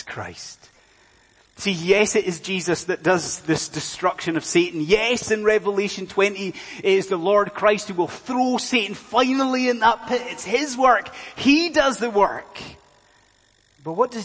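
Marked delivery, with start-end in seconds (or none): 1.6-12.6 raised, insistent pulpit voice; emphatic proclamation, building higher; forceful preaching. Real, not acted. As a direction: hammer it home to the congregation.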